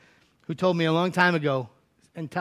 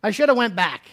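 loud, high grumbling complaint; whiny complaining Israelites; 7 semitones higher, 7 dB louder than usual. Acted. Grumbling wanderers protesting to Moses, high and loud. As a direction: whine at Moses like starving people.

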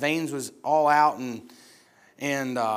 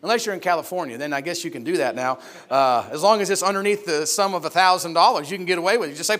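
ordinary talk, nothing marked

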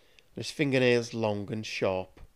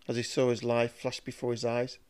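plain reading voice

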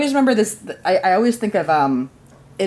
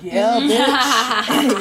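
gloating laugh; 8 semitones higher than usual. Retold or imitated. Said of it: "And I'm like".

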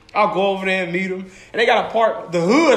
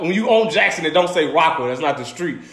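ordinary delivery

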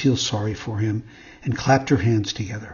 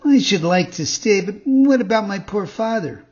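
higher, louder pleading little puppet voice; young puppet; 6 semitones higher, 5 dB louder than usual. A narrator's reading. Puppet asks the fairy about his father, high and pleading. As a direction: plead as the little marionette, pitched up.

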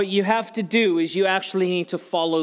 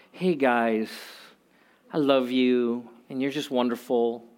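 soft, lower, gushing gentle plea; overly sweet; 5 semitones lower, 3 dB quieter than usual. Pretend. Paul wrongly imagined sweet-talking the church, softer and lower. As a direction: sweet-talk them, far too gentle. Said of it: "his tone is not".

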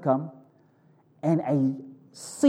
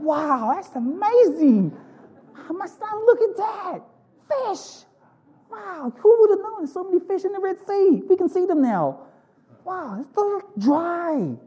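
much higher, wide-eyed wonder at the fish; awed Israelite; slower than usual. Acted. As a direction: play a marvelling child seeing the sea walls. Voicing awed Israelites crossing.

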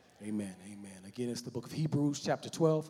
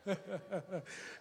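short soft laugh; 4 semitones higher, 11 dB quieter than usual. Real, not acted. Laughs at himself.

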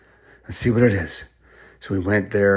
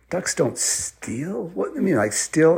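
high, incredulous questioning; pitched-up child's confusion; puzzled kid; 7 semitones higher, slower than usual. Acted. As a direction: draw it out in baffled disbelief. Children puzzle over riddle.

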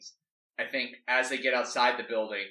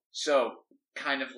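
usual speaking voice

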